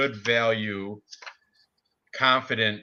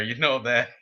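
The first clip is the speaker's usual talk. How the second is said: ordinary talk, nothing marked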